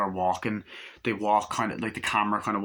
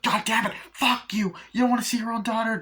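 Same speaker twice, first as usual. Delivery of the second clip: furious mother; 12 semitones higher, 3 dB louder than usual. Retold or imitated. Mother swears at doctors, furious.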